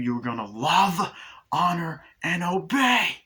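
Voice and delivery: slow, building to high and loud; imitated domineering doll; commanding vows. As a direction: hammer out each vow as an order. Imitates Chucky ordering bride.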